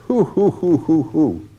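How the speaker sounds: high, hooting mock laughter; mocking hoots. A narrator's reading. Lynch jeering at Bloom in the mirror, hooting laughter. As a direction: hoot the laugh at him like an owl. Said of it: "He laughs".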